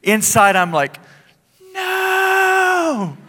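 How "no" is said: inner groan. Retold or imitated. Inside, reluctant to minister.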